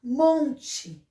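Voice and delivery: "Monte" with MON held out; "MON-te", deliberate dictation; strong syllable shown. Shown as a demonstration. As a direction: sound out "Monte", leaning on MON. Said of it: "a sílaba mais forte".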